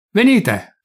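high, loud summons; sudden loud summons; exclaimed. A narrator's reading. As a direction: call them back sharply. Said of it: "esclamò Pilato".

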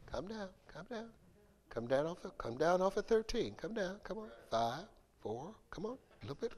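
hushed, soothing de-escalation; calming someone down. Retold or imitated. Someone mid-argument soothing the other, hushed. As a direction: talk a hothead down softly. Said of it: "in the heat of the argument and they say".

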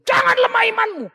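high loud pulpit shout; mock preacher yell. Preacher shouting at the congregation from the pulpit, loud. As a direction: bellow it like a fiery preacher. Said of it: "Terus kau teriak di sini".

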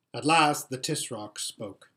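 plain reading voice

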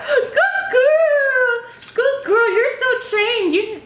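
high squeaky dog praise; squeaky praising a dog. Real, not acted. Dog just did its tricks.

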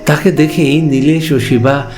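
ordinary delivery

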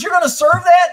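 scandalized at the fried chicken; high, loud mock disbelief; appalled question. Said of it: "He goes".

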